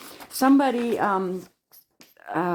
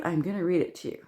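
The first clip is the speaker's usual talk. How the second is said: normal talking voice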